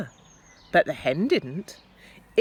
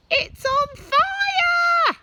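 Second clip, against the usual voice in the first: very high, slow, loud screaming granny; Grandma yelling; 8 dB louder, much slower than usual. A narrator's reading. Grandma yelling from the roof that the hen burns. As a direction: shriek the alarm like a panicked old woman. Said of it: "Grandma yelled".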